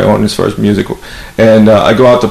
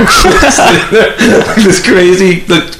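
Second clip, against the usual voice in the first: laughing; 9 semitones higher, slower than usual. Real, not acted. Laughing while telling.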